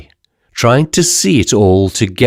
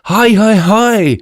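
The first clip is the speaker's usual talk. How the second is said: bawling drivers; 11 semitones higher, slower than usual. Acted. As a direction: yell hi to clear the way. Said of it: "bawled the omnibus drivers".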